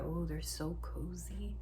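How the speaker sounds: ordinary delivery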